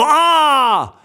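short startled scream; mock scream. Cyclist braking and yelping in fright. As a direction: yelp of fright at the sudden stop.

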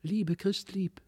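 high, soft lisping doll voices; dainty doll voices, high and hushed; fine little voices. A narrator's reading. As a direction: whisper it like tiny dolls. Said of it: "lispelten mit feinen Stimmchen".

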